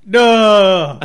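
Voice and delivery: loud, high hammed-up denial; a sudden mock-indignant shout. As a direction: bark the denial in fun.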